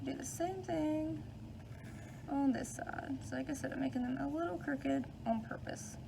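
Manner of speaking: ordinary delivery, nothing marked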